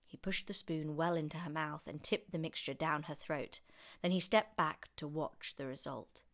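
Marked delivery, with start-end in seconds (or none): none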